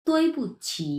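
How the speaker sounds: "তৈ পুচ্ছি" with a high falling first syllable; careful model pronunciation. Shown as a demonstration. Teacher models a new word, said carefully for clarity. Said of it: "আমি একটা word দুই থেকে তিনবার উচ্চারণ করছি".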